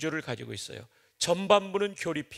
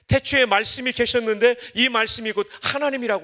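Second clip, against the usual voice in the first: preaching at full force; 5 semitones higher, 6 dB louder than usual. Real, not acted.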